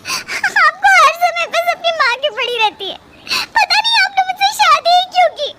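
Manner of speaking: high, tearful wife lashing out; tearful complaint. Acted. Wife complains to husband about his mother, tearfully. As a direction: complain through rising tears. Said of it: "जिज्ञासा रोते हुए अपने कमरे में चली जाती है".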